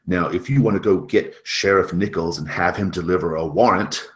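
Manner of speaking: loud, angry refusal at the door; arguing. Acted. Adeline argues in the hallway with Catherine, loud and angry. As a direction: send her away with real force. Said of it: "having an argument".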